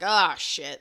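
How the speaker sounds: demonstrated oh shit. Shown as a demonstration. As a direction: stretch the first word, then let it drop. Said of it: "he's saying it more like".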